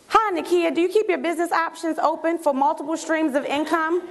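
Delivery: mock formal, rehearsed sales greeting; scripted pitch. Recruiter reading a script to a close friend, stiffly formal. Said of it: "I don't read scripts to someone".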